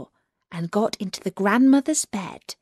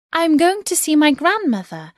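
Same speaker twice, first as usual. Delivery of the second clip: put-on little girl voice; 8 semitones higher, 4 dB louder than usual. Girl answers the wolf.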